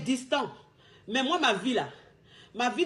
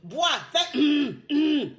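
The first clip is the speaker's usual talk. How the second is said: throat clearing; 2 semitones higher, much slower than usual. Shown as a demonstration. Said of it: "Faut faire comme ta coach".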